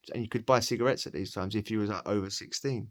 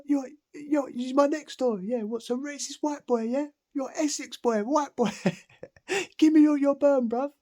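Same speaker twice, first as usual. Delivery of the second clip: high, loud jeering inmate with a laugh; mocking inmate voice; 4 dB louder than usual. Inmate calls the others about the new boy, high jeering voice. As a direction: sneer it up high like a cocky lad. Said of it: "calling up whoever".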